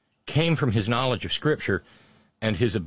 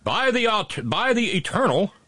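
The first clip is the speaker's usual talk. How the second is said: Andrew Jackson quote; 11 semitones higher than usual. Retold or imitated. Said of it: "he tended to say things like".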